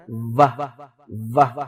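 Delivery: the v sound shown; v sound demo. Shown as a demonstration. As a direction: buzz "وہ" through teeth and lip. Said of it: "اوپر والے دانت اور نیچے والے ہونٹ".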